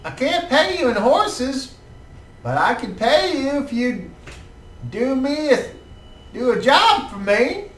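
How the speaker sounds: put-on high, eager old-man voice; hopeful old man asking a favour; old tanner. Old tanner NPC continues.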